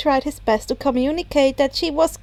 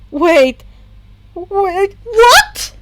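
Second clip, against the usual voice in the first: rising to a high, loud what; stunned; 7 semitones higher, 7 dB louder, much slower than usual. A narrator's reading. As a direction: disbelief building up. Stunned reaction to revelation.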